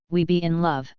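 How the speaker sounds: a lightly voiced manly declaration. A narrator's reading. Lena tells the crying prince they're together, in a lightly manly voice. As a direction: state it firmly, like a gallant hero. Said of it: "and said manly".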